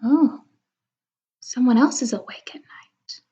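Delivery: ordinary delivery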